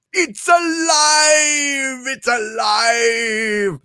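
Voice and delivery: slow, high shout of triumph; hammed-up mad scientist shout; mad scientist cry. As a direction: cry out like Frankenstein over his creature. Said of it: "Frankenstein quote, shouted".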